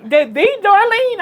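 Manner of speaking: high, loud starstruck question; as if meeting a celebrity; amazed. Retold or imitated. Social worker asking if she's the Darlene, amazed. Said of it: "she's like".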